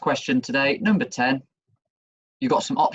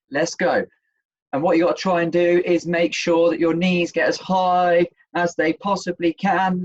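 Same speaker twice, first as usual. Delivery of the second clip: upbeat, rising energy, higher toward the end; aerobics instructor; 3 semitones higher than usual. Acted. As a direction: pump up the class like a fitness coach. Leads fitness exercise.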